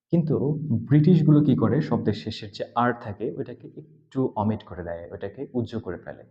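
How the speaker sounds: normal talking voice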